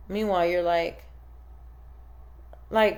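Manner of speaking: ordinary talk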